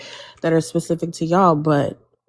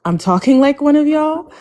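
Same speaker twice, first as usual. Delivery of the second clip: incredulous; 9 semitones higher, 4 dB louder than usual. Real, not acted. Incredulous echo of the accusation.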